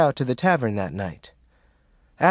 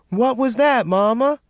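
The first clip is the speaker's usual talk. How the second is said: put-on scared child; 10 semitones higher, 3 dB louder than usual. Frightened child at night.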